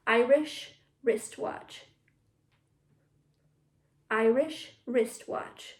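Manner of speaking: slow, separated tongue twister; careful model take. Shown as a demonstration. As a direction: take it slowly so the class can copy.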